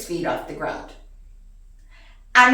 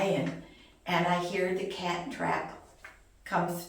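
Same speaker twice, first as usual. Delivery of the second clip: ordinary delivery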